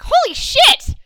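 high loud exclamation; sudden loud shocked cry; husband shouting. Retold or imitated. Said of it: "my husband was like".

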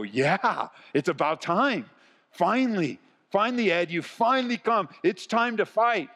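mock excitement, climbing very high in pitch; fired-up supporter. Pastor imitating people who saw the series title, fired up. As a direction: play an eager supporter itching for battle. Acting out eager supporters' reaction.

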